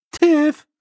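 very high, loud call of the name; insistent call. Acted. Nanny calls dazed girl a second time, insistent. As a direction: call sharply to wake someone from a daze. Repeats, snapping fingers at her.

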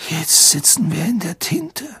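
high, half-whispered rasp; husky, breath-heavy young voice; hoarse. A narrator's reading. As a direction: croak it in dismay. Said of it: "sagte er heiser".

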